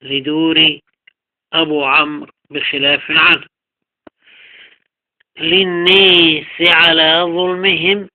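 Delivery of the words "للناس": tilt the long aa of للناس toward "ee"; slow, drawn-out للناس as lin-nees; imala. Shown as a demonstration. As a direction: say للناس with a raised, fronted long vowel. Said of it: "للناس إمالة".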